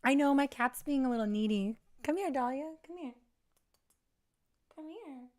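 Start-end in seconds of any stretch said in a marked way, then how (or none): 2.0-5.3 calling a pet. Real, not acted. Calling the cat.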